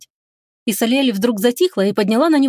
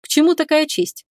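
higher-pitched surprised question; undisguised surprise; 7 semitones higher than usual. A narrator's reading. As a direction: ask it with open surprise. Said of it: "Дарий не скрыл своего удивления".

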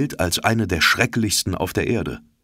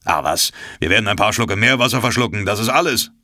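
a touch louder, shrugging off danger; breezy harpooner; 3 semitones higher, 3 dB louder, faster than usual. A narrator's reading. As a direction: wave the worry away. Ned voiced in read story.

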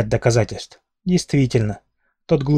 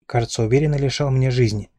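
usual speaking voice

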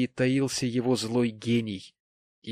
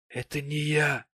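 maudlin tipsy gentleman; 5 semitones higher than usual. Acted. Voices maudlin Mr Pecksniff.

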